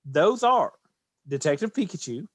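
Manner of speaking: ordinary talk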